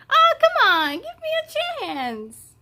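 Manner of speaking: whiny pleading monster. Acted. Defeated Limbo begging Alma, high squeaky whine. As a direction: beg like a beaten cartoon villain.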